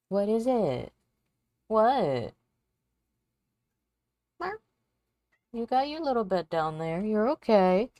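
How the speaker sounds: high, loud cooing pet voice. Real, not acted. Working alone, cooing to her cat's meows.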